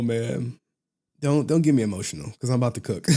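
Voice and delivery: usual speaking voice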